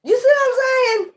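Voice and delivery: very high squeaky laughing question; nearly cracking up mid-word; laugh-crying. Real, not acted. Laughing crying at own bit.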